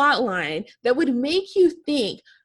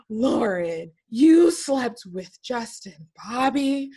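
slow, voiced gullible viewer, mock shocked; hammed-up shocked fan; slower than usual. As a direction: play the dumb fan who believes it. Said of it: "that we were gonna go".

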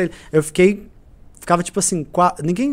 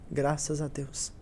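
slow, much softer, almost breathless thanks; hushed relief; 2 semitones lower, 12 dB quieter, much slower than usual. Retold or imitated. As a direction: let the gratitude sink the voice down. Poor mother receiving food basket.

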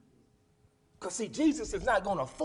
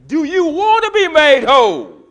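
ringing preached question; 8 semitones higher, 19 dB louder than usual. Acted. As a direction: call the question out over the church. Jesus asks the man.

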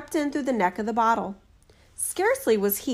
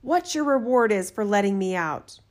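ordinary delivery

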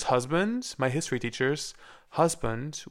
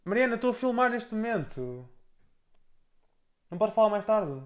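calling out annoyed; 8 semitones higher, faster than usual. Real, not acted. Calling to sister, Mariana.

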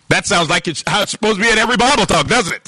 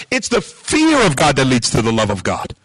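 usual speaking voice

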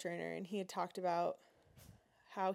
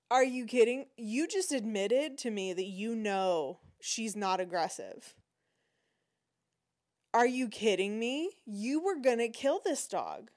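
loud, incredulous challenge; outraged disbelief; 3 semitones higher, 5 dB louder than usual. Retold or imitated. Speaking to the animal control officer, loud outraged disbelief. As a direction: demand how he could say that.

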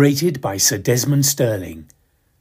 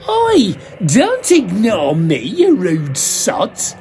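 put-on drunken rant; 8 semitones higher, slower than usual. Drunk actor berating the audience, indignant slow rant. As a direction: berate the crowd for ignoring you. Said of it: "his ranting".

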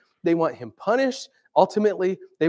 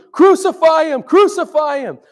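much higher, loud mob shout; crowd shouting; 13 semitones higher, 11 dB louder than usual. Retold or imitated. Preacher voicing the mob before Pilate, loud shout. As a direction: yell it like the angry crowd. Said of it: "they kept shouting".

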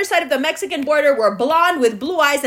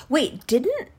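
ordinary delivery

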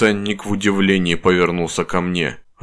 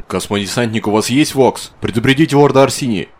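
urgent; 5 semitones higher, 4 dB louder than usual. A narrator's reading. Urgent call to the marine.

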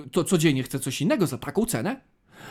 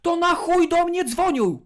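much higher, louder exasperated cry; exasperated outburst; 11 semitones higher, 4 dB louder than usual. Real, not acted. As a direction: blurt it out in outrage. Exasperated rant about spam calls.

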